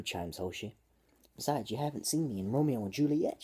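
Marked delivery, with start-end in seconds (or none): 1.4-3.4 raised, louder dramatic voice; shouting. A narrator's reading. Girl protesting to the dying captain, shouting. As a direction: call it out with theatrical swings in pitch. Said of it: "she shouts".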